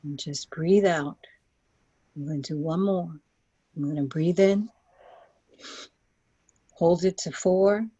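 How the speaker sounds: calm them down into the journey. Acted. Leads a guided visualization.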